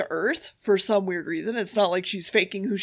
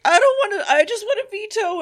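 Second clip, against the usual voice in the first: petulant, higher and louder, laughing through the second half; whiny high mock voice; 11 semitones higher, 4 dB louder than usual. Recapping the reluctant delegate for the co-host, high whiny mock voice. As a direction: pitch it way up and whine like a sulking delegate. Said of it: "Penis character is going just like".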